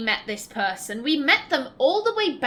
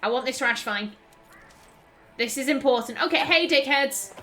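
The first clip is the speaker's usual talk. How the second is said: ordinary talk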